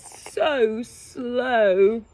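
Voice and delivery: very slow, stretched words; slowed right down for effect; drawn-out slow. A narrator's reading. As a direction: drag it out at a snail's pace. Narrator slows down for slow snail.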